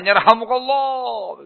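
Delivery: high, drawn-out mock crowd call; echoing crowd chorus. Whole market answers the sneeze, echoing high chorus. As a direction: let it ring out long and high without shouting. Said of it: "Begitu, menggema".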